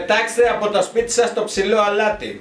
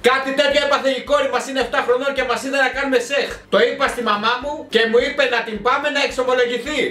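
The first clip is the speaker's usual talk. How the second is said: quick, higher gush of a worried mom; rushed breathless reading; 5 semitones higher, faster than usual. Retold or imitated. Comedian rushing through a mom's long post, fast and higher. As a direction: rattle the post off fast and high, all in one breath. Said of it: "Και αρχίζει".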